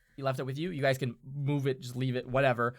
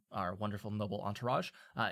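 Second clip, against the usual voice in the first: ordinary talk, nothing marked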